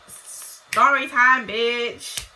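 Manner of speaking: loud, drawn-out announcement; story time call-out. Real, not acted. Telling viewers a childhood story is coming, loud drawn-out call-out. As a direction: belt it out slowly to kick off a tale.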